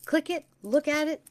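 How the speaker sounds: high, loud chirpy voice for a web button. Pretend. Host playfully voicing the website link for her viewers, high and loud. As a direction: squeak it brightly like a cheerful button begging to be clicked. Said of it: "where it says".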